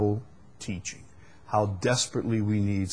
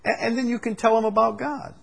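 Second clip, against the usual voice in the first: mocking quote; 11 semitones higher, 7 dB louder than usual. Mocking the easy-gospel preachers' advice.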